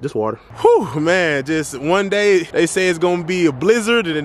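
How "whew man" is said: usual speaking voice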